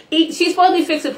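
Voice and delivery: incredulous. Retold or imitated. Mocking the mother eating the food.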